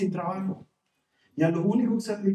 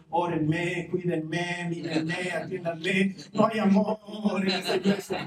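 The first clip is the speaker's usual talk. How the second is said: mock needy churchgoer; 2 semitones lower, much slower than usual. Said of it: "lo que siempre les digo".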